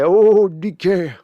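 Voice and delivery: put-on high, loud, slow old grandpappy voice. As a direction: coax him like a doting grandpa. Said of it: "I don't know why I'm doing this voice".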